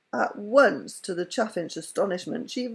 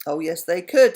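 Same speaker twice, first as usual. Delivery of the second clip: grimly; 8 dB louder than usual. A narrator's reading. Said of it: "said Hey Presto grimly".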